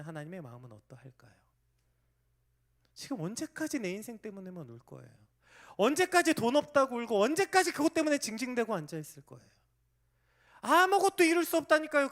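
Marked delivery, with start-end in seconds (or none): 5.8-12.1 loud, high scolding; preaching rebuke. Real, not acted. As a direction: scold them at full force. (회중을 꾸짖는 설교자, 높고 크게 외치며)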